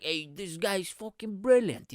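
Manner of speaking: drawn-out mock Detroit Italian-American; Vito. Audience member praising the comic after the gig, Italian-American accent. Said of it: "This is how he sound".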